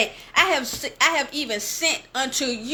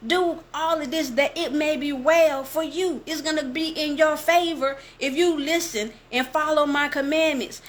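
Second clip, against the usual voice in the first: normal talking voice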